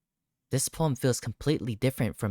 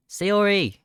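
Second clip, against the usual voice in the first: high, loud call to the house; 9 semitones higher, 6 dB louder than usual. Acted. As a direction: call her name through the door. Calling at her front door.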